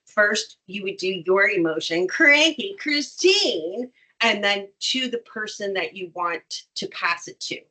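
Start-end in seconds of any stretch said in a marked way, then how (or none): 2.1-3.9 higher, drawn-out demo of cranky; playfully exaggerated name call; mock cranky. Shown as a demonstration. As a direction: lightly act out cranky, stretching each word. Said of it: "passing the emotion that goes to it".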